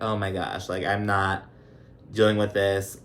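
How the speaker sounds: ordinary delivery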